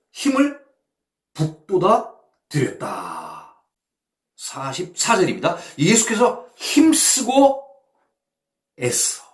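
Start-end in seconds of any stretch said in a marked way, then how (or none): 5.8-7.7 loud, high and slow; preacher pressing the verse; emphatic reading. Shown as a demonstration. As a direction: stretch out 힘쓰고 with force.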